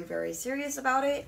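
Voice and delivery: usual speaking voice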